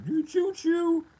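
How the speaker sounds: high, drawn-out mock blubber; mock crying. Crybaby complaining about losing, mock crying. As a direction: imitate a whiny sob in a high pitch. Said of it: "They're crying and shit".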